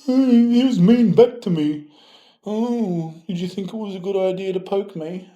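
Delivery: high, loud crybaby whine; hammed-up crybaby voice; whiny commenter. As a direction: whine like a kid telling on you. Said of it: "gonna cry about it".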